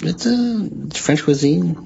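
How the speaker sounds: slow, hammed-up French cuisine; mock French cuisine. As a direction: say it like a fancy menu, slowly. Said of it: "he's a fucking pip".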